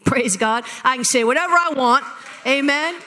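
loud, joyful praise; shouting praise to the congregation; jubilant. Real, not acted. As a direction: lift the church up with it. Preaching climax.

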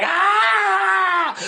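sudden high yelp like a fleeing demon; a startled manifestation shriek; mock demon scream. Preacher mimics a demon fleeing the angels, high shout. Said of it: "They be like".

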